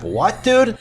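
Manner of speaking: high, stunned disbelief; surprised. Retold or imitated. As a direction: react in disbelief. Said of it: "And I was like".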